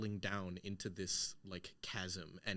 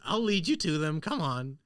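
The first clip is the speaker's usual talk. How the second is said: lightly voiced hag, a bit higher and louder; a hint of the hag luring them on; 6 semitones higher, 8 dB louder than usual. Hag leading the player to her friends: lightly voiced hag. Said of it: "she goes".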